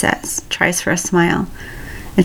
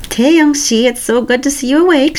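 bright, much higher nurse greeting; happy nurse; 8 semitones higher than usual. Acted. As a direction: greet him cheerfully like a nurse. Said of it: "she greets happily".

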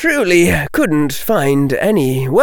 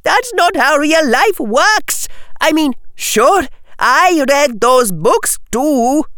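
high, slow insistent argument; yelled protest. Acted. Parvati arguing about real life, yelled protest. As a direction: argue back in a high girlish voice. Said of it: "yelled Parvati".

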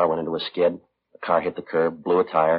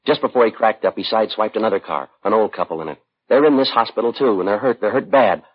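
building to a louder, higher, stern rebuke; rising anger; 4 semitones higher, 4 dB louder than usual. Acted. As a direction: hammer the facts home at him. Officer confronts the reckless father.

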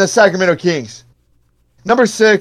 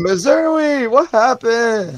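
higher, slow taunting voice; mocking quote; 6 semitones higher, much slower than usual. Imitating a rival's taunting post, slow and mocking. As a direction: drawl it out like a smug rival fan. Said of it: "He would do something".

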